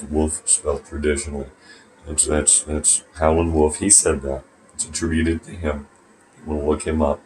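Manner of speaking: ordinary delivery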